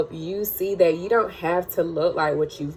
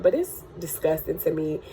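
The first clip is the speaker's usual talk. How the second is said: normal talking voice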